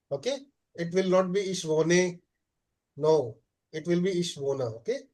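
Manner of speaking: ordinary talk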